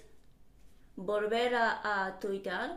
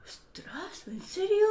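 whispered surprise; 8 semitones higher than usual. Real, not acted. Amazed the guess fails.

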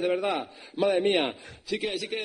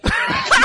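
big high laugh bursts out; loud laughing burst; 14 dB louder than usual. Real, not acted. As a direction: explode into laughter.

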